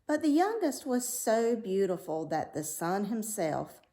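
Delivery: ordinary delivery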